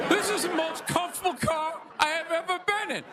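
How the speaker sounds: high-pitched car-ad impression; Shaquille O'Neal. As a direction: deliver it as a celebrity car endorsement. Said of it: "when he was selling Buicks".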